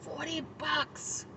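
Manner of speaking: soft, drawn-out disbelief; hushed dismay. Retold or imitated. Speaker hearing what the meal costs, hushed dismay. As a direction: let the price out slowly and quietly.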